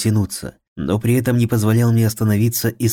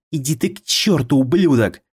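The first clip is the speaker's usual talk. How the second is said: high furious shout; angry shout; 6 semitones higher than usual. A narrator's reading. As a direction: scream the curse at him. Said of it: "выкрикнула моя призрачная подруга".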